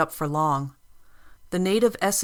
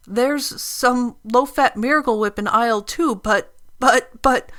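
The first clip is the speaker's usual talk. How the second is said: stammering fear; 7 semitones higher, 7 dB louder than usual. Acted.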